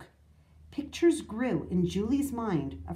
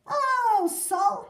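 high, drawn-out hailing; hearty greeting; 14 semitones higher, 7 dB louder than usual. A narrator's reading. Grandfather voicing the president's greeting, grand and hearty. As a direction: hail an old friend grandly.

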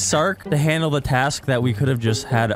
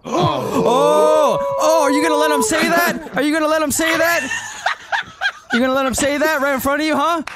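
shocked excited shouting; 11 semitones higher than usual. Real, not acted. Friend says a slur on stream.

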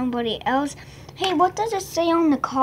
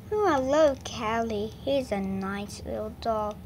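ordinary talk, nothing marked

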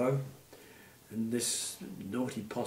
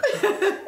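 high laughter; 8 dB louder than usual. Real, not acted. Laughing at the story.

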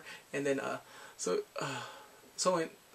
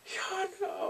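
mock crying; 4 dB quieter than usual. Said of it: "Oh my god, like".

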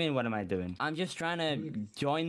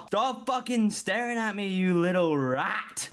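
angry yell at staring man; 6 semitones higher, 3 dB louder than usual. Acted. Yelling at staring NPC.

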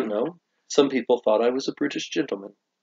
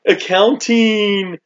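mock excitement; 7 semitones higher, much slower than usual. Co-host teases partner over pricing papers, mock excitement. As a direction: stretch each word in mock wonder.

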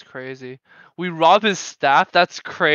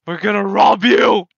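mock shout; 2 semitones higher, 6 dB louder than usual. Announcing robbery to prisoner.

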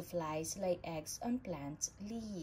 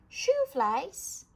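high, loud shoo at the flies; shooing call; 9 semitones higher, 9 dB louder than usual. A narrator's reading. Shooing flies away at picnic.

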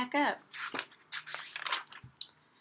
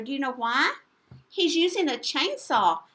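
ordinary delivery

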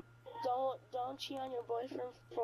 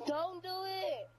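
eerie slow plea; 6 semitones higher, slower than usual. Acted. Prank caller's eerie warning.

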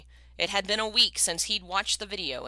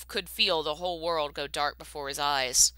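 ordinary delivery

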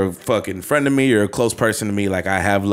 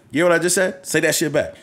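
higher-pitched, lightly indignant demand; mock mad; 7 semitones higher than usual. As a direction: act mildly put out, demanding an answer. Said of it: "I get mad when they don't say it back".

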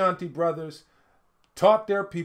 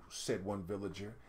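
ordinary delivery, nothing marked

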